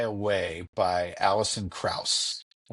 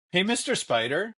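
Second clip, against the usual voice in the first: put-on higher-pitched child calling to a spider; lightly voiced curious kid, pitched up; imitated little girl; 8 semitones higher than usual. Young daughter addressing a spider on the wall, imitated child voice.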